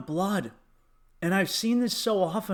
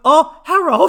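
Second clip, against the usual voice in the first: high squeaky mock greeting, much louder; 10 semitones higher, 12 dB louder than usual. Mocking a comedian's fans, high and loud. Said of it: "and going".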